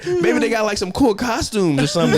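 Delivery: high, sing-song false curiosity; fake innocent. Dad luring daughter under the stage, mock sweet high voice. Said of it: "He's like".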